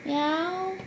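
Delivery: put-on cute meow for a toddler; mock kitty meow. Shown as a demonstration. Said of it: "What does a kitty say?".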